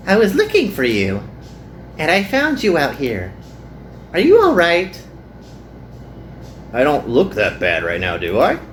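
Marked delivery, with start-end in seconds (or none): 0.1-5.0 put-on higher, bright concerned girl's voice; cheerful Hikari. Girl finding her friend in the heat, higher and caring. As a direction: ask kindly with a lift.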